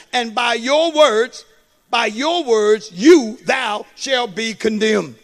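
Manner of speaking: slow, weighty, each word leaned on; slow emphatic reading. Shown as a demonstration. Preacher driving the verse home to the congregation, slow and weighty. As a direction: spell out the verse slowly and heavily. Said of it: "Watch this now".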